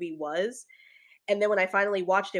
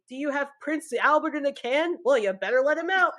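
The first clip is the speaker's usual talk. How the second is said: higher, louder clown prank-call voice; Pennywise; 7 semitones higher, 4 dB louder than usual. Retold or imitated. Quoting the movie clown's famous part, taunting and hammed-up. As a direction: taunt them with a teasing clown question. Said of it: "his part where he's like".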